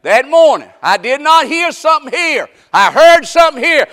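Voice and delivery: high, shouted preaching cry; full-force preaching. Acted. Preacher shouting to the congregation, full-force preaching. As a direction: preach it out to the whole church.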